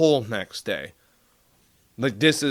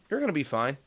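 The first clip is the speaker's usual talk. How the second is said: ordinary delivery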